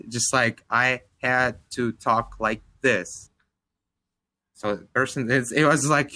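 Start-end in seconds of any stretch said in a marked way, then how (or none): 0.7-3.3 slowed, careful speech to a foreigner; slow, deliberate talk. Shown as a demonstration. Explaining his slowed voice to a friend, slow and careful. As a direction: spell each word out slowly to be understood. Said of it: "slow down my, like, timbre and, like, the speed of my voice".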